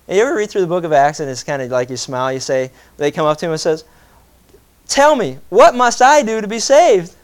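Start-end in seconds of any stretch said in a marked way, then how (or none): 4.9-7.1 high, loud and slow pleading question; urgent plea. Retold or imitated. Seeker running up to ask the preacher, urgent plea. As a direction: beg to know how to be saved.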